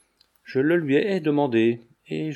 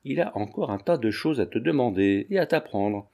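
ordinary delivery

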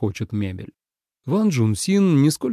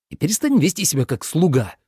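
higher-pitched angry order; infuriated; 5 semitones higher than usual. A narrator's reading. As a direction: lose your temper at the servility. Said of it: "совсем взбеленился Минлу". (взбешённо)

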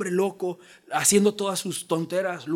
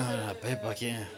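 indifferent shrug; 7 semitones lower, 10 dB quieter than usual. Retold or imitated. Childless shrug at lost pig.

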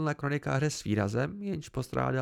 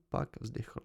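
plain reading voice

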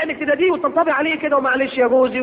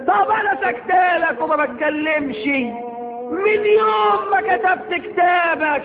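higher, slow wife's complaint; mock nagging wife; 5 semitones higher, much slower than usual. Wife dragging up the past with her husband, slow mocking whine. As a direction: whine out the old grievance. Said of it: "تقول له".

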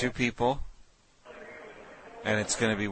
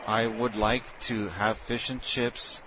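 slow deliberate request; 2 semitones higher, slower than usual. Acted. Prank caller's slow request.

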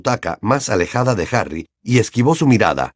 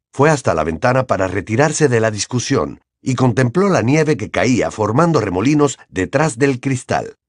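usual speaking voice

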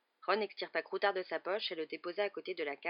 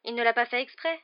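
higher, louder lilt, rising at the end; excusing a pet; 5 semitones higher, 9 dB louder than usual. A narrator's reading. Girl defending her new cat to friend, excusing lilt. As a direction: plead the pet's innocence.

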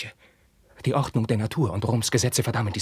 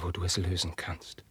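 ordinary talk

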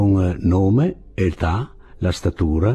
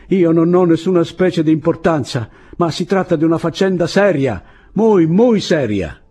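high, raised voice slowing at the end; urgent appeal; 9 semitones higher, 5 dB louder than usual. A narrator's reading. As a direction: plead loudly that it matters. Urgent shouted appeal at the parapet.

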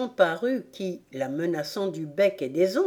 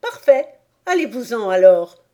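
loud, brusque dismissal; surly pigeon, raised voice; gruff; 4 semitones higher, 10 dB louder than usual. A narrator's reading. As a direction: send them off with irritation. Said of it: "conclut le pigeon d'un ton bourru".

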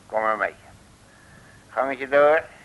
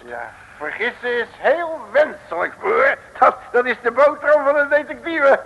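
higher, louder, smug boast into laughter; gloating; 12 semitones higher, 11 dB louder than usual. Acted. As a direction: rub in the victory.

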